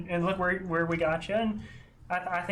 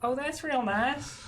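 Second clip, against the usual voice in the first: pitched up, slow and touched; touched character voice; 7 semitones higher than usual. Acted. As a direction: sound genuinely moved. Keely reacts in character.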